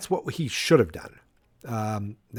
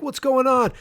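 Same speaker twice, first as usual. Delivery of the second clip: high, startled "What's going on?"; alarmed parents; 12 semitones higher, 3 dB louder than usual. Retold or imitated. George and Lorraine walk out: high startled question. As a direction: ask in sudden alarm.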